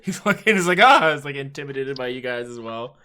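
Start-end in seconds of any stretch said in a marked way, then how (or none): 0.8-1.1 high, loud frightened yelp; startled yelp. Acted. Frightened priest yelps at the armed party, high and loud.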